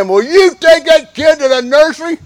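high, loud affronted retort; indignant grandparent. Retold or imitated. Grandpa snapping back at a complainer, high indignant outburst. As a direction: bristle like a grandpa defending his baby. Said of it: "somebody ever said".

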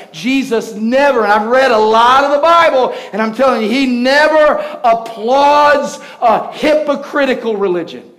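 loud, high, building preacher's roar; preaching at full force. Acted. Preacher roaring at the congregation about hypocrisy, loud and high. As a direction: thunder it out, rising with every phrase.

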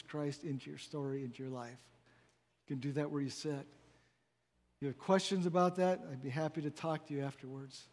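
soft, hushed invitation; altar call. Real, not acted. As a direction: gently invite while heads are bowed. Quiet invitation during bowed heads.

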